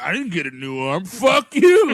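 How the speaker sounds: put-on barfly grievance bursting into a shout; grumble, then yell the curse high and loud; mock resentful outburst; bitter. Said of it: "he's, like, bitter about it".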